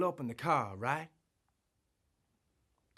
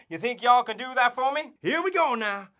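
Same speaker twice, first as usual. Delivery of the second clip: ordinary talk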